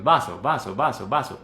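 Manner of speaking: "vaso" short and colloquial; quick everyday delivery. Shown as a demonstration. Teacher showing colloquial Spanish, quick everyday "vaso". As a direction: rattle off "vaso" quickly, as in daily talk. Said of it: "cuando lo decimos rápido".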